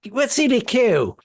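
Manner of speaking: high poor-Londoner voice; mock Cockney. Playing the poor Brit for co-host, mock Cockney. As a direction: ask it in a put-on Cockney. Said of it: "So you do the poor person".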